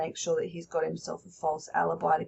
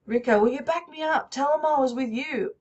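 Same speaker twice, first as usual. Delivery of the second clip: desperate; 6 semitones higher, 3 dB louder than usual. Acted. Teacher voices Rico begging.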